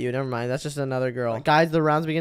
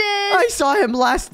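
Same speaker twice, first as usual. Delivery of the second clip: frightened whine; 13 semitones higher, much slower than usual. Real, not acted. Still fleeing the monster.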